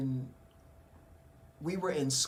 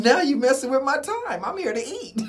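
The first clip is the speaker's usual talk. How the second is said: louder and higher, laughing through it; loud brush-off; 10 semitones higher, 9 dB louder than usual. Retold or imitated. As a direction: tell her off loudly with a laugh. His loud brush-off retold.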